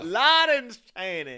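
slow, loud laughing Scottish; Scottish accent. Retold or imitated.

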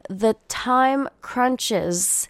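very slow, drawn out; slow theatrical "The time crunches"; drawn-out drama. Acted. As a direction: stretch each word dramatically. Dramatic aside about time.

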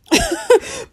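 bright high burst of laughter; a real amused giggle. Real, not acted. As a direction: laugh at your own example. Laugh after self-reflection example.

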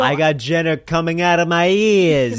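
loud, high, drawn-out boast; mock boastful holler. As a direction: belt it out as a joke brag. Playful boast over the other speaker.

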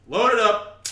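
high, loud, gung-ho shout. Acted. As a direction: yell it like a gunner. Acted helicopter gunner scene.

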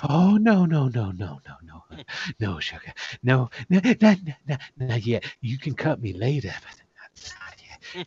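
coaxing, hushed "not yet" voice; soothing character refusal. Acted. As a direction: gently fend off an attacker, ending in a whisper. Game master voices character soothing attacker.

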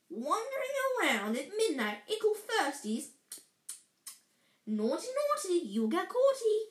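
high, gleeful sing-song taunt; Peeves. Acted. Poltergeist catching first-years out at midnight, gleeful taunt. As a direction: squeal it teasingly, like a mischievous sprite. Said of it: "gave a squeal of delight".

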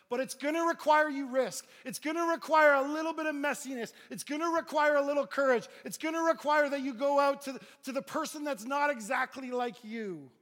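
high, very loud exhortation; impassioned shouted sermon; preaching at full force. Real, not acted. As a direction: drive each phrase home to the congregation. Preaching climax.

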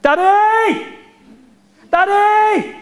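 imitating daughter screaming for daddy; child screaming Daddy. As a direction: shriek it like a scared kid calling dad. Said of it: "she was screaming".

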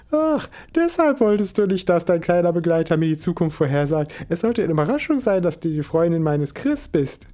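delighted esoteric mum; cheerful mother. Acted. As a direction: gush over the surprise.

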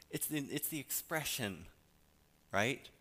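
normal talking voice

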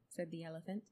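usual speaking voice

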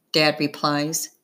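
ordinary delivery

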